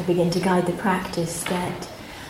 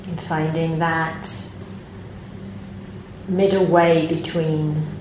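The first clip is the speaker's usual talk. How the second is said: calm, unhurried meditation guidance with long pauses; guided meditation; 4 dB quieter than usual. Acted. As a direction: gently guide the group into their posture and body.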